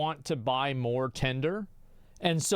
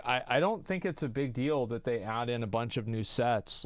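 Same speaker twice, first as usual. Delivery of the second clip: ordinary talk, nothing marked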